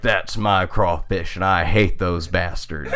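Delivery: low mock old man menace; gruff old man. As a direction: growl it like a grumpy old man with a gun. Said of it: "and he is like".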